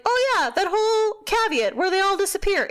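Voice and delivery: mock-dumb high sarcastic voice. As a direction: fake dawning realization, dripping irony.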